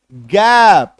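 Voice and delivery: गैप said loud with a long, pushed ae vowel; forceful gap. Shown as a demonstration. Teacher demonstrating word sounds, forceful गैप. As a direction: give गैप a big stretched ae. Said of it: "थोड़ा जोर लगा के करेंगे".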